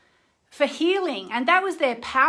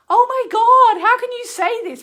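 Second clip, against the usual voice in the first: high, loud, shrieking mock outrage; mock outraged viewer; 12 semitones higher, 12 dB louder than usual. Imagined outraged viewers reacting to her talk, high loud mock shock. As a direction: squeal it like an offended commenter.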